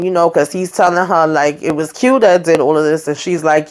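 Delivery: ordinary delivery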